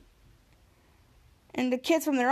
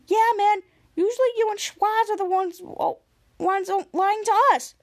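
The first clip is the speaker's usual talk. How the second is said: arguing back; 7 semitones higher, 4 dB louder than usual. Retold or imitated. Miles arguing back at Ray.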